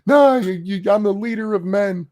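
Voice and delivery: high-pitched, loud mock leader's protest; Andrew Tate. Man mocking a self-declared leader of men, high whiny send-up. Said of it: "like Andrew Tate. Like".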